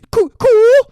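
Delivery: very high, loud frightened reply; panicked answer. Retold or imitated. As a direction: blurt it out terrified. Honey Bunny's shaky answer.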